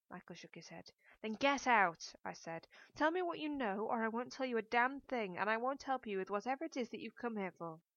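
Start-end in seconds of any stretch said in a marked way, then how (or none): 1.2-2.1 loud, sharp command to leave; loud furious dismissal; angry order. A narrator's reading. Narrator orders Michael out. 2.9-7.8 higher, louder furious demand; loud raised-pitch threat; angry ultimatum. A narrator's reading. Narrator threatens Michael in a quarrel.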